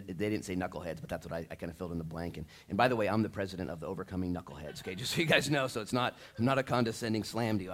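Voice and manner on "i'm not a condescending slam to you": usual speaking voice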